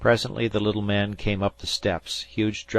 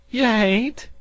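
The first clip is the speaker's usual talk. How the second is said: higher still and loud, pleading; urgent cry; 9 dB louder than usual. A narrator's reading. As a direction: repeat it, more desperate. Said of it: "cried the sea farmer".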